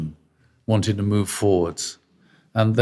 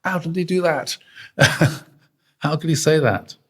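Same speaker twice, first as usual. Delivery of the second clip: incredulous inner voice; 6 semitones higher than usual. Retold or imitated. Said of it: "I thought".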